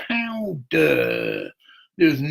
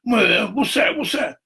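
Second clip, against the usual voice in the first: quoting the officer; 6 semitones higher, 4 dB louder than usual. Retold or imitated.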